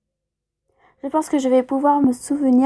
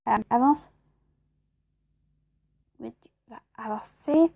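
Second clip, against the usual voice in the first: normal talking voice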